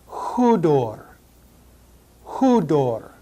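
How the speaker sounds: Hudor with a breathy H at the start, HOO-dor; rough breathing. Shown as a demonstration. Teacher showing the Greek rough breathing, breathy H. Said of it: "Notice the rough breathing".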